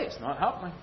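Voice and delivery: ordinary delivery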